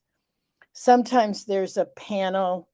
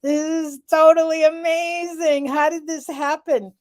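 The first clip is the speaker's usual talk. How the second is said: thrilled, high and bright; excited; 6 semitones higher, 3 dB louder than usual. Retold or imitated. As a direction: gush with delight. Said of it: "And moments of".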